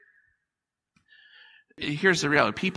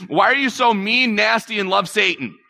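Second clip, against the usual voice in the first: sharp, higher, louder accusing jab; mock accusation; 5 semitones higher, 3 dB louder than usual. Accuser picking a fight with a loud jab. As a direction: fire off a nasty accusation. Harsh accusing startup quote.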